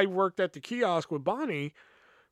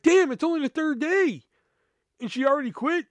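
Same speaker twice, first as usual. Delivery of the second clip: shocked; 10 semitones higher, 4 dB louder than usual. Retold or imitated. Shocked manager quit so soon.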